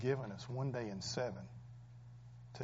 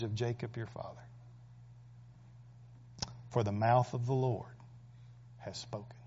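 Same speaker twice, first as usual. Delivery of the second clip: scripture reading; 2 semitones higher, 10 dB louder than usual. Acted.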